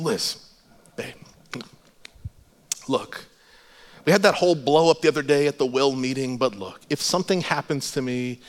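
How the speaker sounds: low, soft, halting sincerity; emotional, heartfelt. Retold or imitated. Husband overcome with emotion in traffic, tenderly blessing his wife. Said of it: "this wave of emotion just rolls over me".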